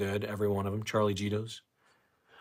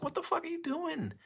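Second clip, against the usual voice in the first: incredulous; 7 dB quieter than usual. Retold or imitated. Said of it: "I'm like".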